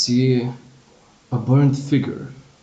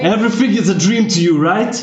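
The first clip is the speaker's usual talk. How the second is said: loud, higher, faster accusing father; angry accusation; 8 semitones higher, 5 dB louder, faster than usual. Acted. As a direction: throw the blame at your son, loudly. GM voices accusing dead father.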